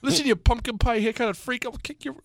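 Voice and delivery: high, put-on tough-guy insult.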